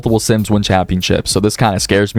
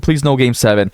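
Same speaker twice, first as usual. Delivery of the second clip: ordinary delivery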